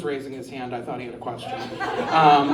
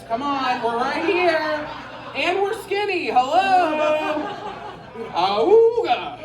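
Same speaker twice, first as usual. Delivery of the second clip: lightly voiced guys, high and laughing, ending in a whale call; mock whale moan at the end; whale noise; 10 semitones higher, slower than usual. Comic imitating guys calling to the lady, laughing whale call. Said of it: "that's the whale noise".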